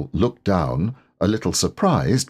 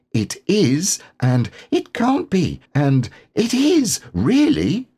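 excited whispers back and forth; 10 semitones higher than usual. A narrator's reading. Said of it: "they were saying".

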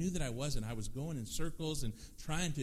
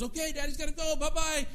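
much higher, louder sing-song daddy voice; baby talk; 14 semitones higher, 8 dB louder than usual. Retold or imitated. Dad greeting his baby son on the phone in high baby talk. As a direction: coo it up high to a toddler. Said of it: "he's talking to him like that".

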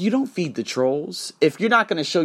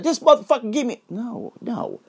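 mock rant; 9 semitones higher than usual.

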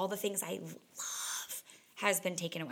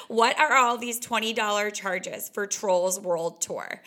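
louder, slow indignant demand; mock complaint; 7 dB louder, slower than usual. Partner finding movie charges on the bill, loud slow indignation. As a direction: play the annoyed bill payer.

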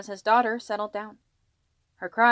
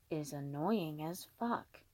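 slow, much softer and lower, sweetly; talking to a baby; 4 semitones lower, 11 dB quieter, slower than usual. Acted. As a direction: murmur it to a baby. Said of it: "continued to talk to the crying baby".

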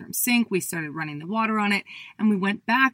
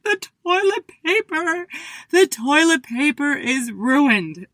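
mock wail; 8 semitones higher, 5 dB louder, slower than usual. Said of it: "I started bawling my eyes out".

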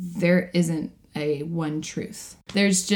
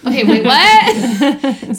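loud, higher, drawn-out disbelief; mock bafflement; 6 semitones higher, 10 dB louder, much slower than usual. Co-host reacting to muddled logic, loud mock bafflement. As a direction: play up being totally baffled, laughter close behind.

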